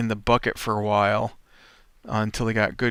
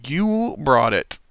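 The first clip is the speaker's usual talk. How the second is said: mock retort; 3 semitones higher, 4 dB louder, slower than usual. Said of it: "retold quarrel, sarcastic retort".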